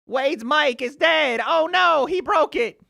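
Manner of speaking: high, loud whiny mock panic; mocking chat. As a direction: mimic the chat freaking out. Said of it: "you're like".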